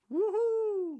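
very high, loud cheer; loud joyful whoop. A narrator's reading. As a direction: whoop for joy at full voice. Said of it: "udbrød et højt".

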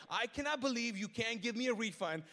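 a hint of an irate customer; mild mock outrage. Upset customer demanding a refund, imitated loudly.

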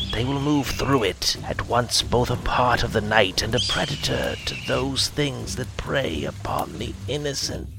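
trance-like whispered oracle; harsh whisper. Acted. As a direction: chant the vision in a harsh whisper. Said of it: "spoke in a harsh whisper".

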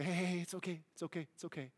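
soft, fading hush of reassurance; soothing a panicked child. Retold or imitated. Parent to a panicked child, soothing and fading to a hush. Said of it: "Let him grab your face and say".

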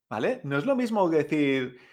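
ordinary talk

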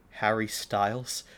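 normal talking voice

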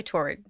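"toward" with an R in both syllables; rhymes with forward. Shown as a demonstration. Host defends her way of saying it, like forward. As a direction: say "toward" as TOR-werd. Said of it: "Like forward".

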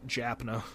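"Japna" said as "Japna", jokingly mangled; weird inflection. Real, not acted. Joking about his Japan trip, "Japna" mispronounced on purpose. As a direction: twist "Japna" into an odd, off version of Japan. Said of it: "I just said it with a weird inflection".

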